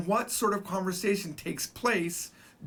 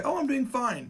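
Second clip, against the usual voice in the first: higher-pitched imitation of a chatty reply; lightly acted small-talk answer; mock cheery reply; 8 semitones higher than usual. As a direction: act out someone answering a greeting, pitched up. Imagined reply to a greeting.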